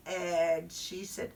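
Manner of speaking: normal talking voice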